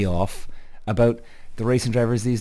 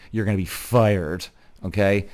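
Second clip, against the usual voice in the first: ordinary delivery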